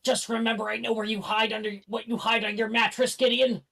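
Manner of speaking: muffled, teasing threat; muffled from under plants. Acted. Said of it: "Morgan's muffled voice".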